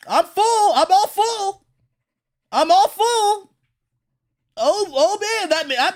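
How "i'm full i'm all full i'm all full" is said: higher, lightly voiced cocky hero; mock boastful Luffy. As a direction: puff up like a hero with a full belly.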